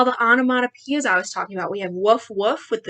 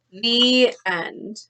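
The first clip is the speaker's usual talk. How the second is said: lively picture-book reading; story narration; 2 semitones higher, slower than usual. Acted. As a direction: read the ending to kids. Teacher finishes reading aloud.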